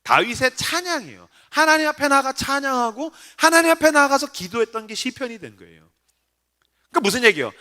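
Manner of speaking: ordinary delivery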